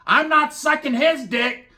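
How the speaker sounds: high, slow, loud mock woman's voice. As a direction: snap back like an offended woman. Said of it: "They're like".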